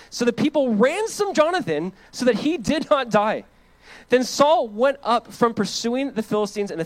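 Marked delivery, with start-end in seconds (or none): none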